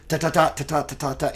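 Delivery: short, choppy taps, one beat each; staccato syllables. Shown as a demonstration. Host showing his own choppy rhythm, sharp staccato taps. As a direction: tap out the syllables like a drum. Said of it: "I'm very staccato".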